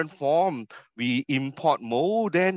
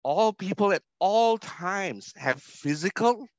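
both "all"s in "all people at all times" pitched higher and hit hard; stress on all; 7 semitones higher than usual. Shown as a demonstration. Speaker defining food security for students, stressing "all". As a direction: hammer every "all" home. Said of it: "and I emphasize the word all".

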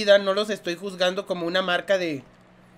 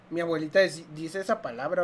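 ordinary talk

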